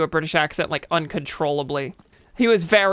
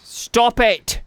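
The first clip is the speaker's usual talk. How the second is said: louder, higher British "Stop it!"; hammed-up British telling-off, louder; mock British shout; 6 semitones higher, 5 dB louder than usual. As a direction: scold the game like a cross Brit. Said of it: "how often I do a British accent".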